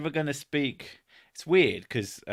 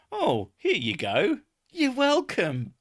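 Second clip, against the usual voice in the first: lightly mocked helpful assistant; sing-song, higher, falsely helpful; obliging gadget; 9 semitones higher, 3 dB louder than usual. Imagined device handing out bank details, mock-helpful. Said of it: "You could just imagine it going".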